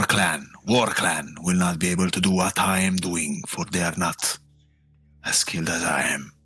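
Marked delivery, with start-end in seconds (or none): 5.2-6.3 whispered. Acted. Boastful aside to goddess.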